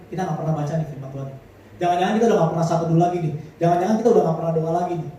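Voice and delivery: ordinary talk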